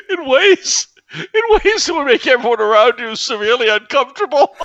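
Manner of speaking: very high, strained voice breaking into giggles; sadistic Joker-like madman. Acted. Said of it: "you're doing this sadistic thing".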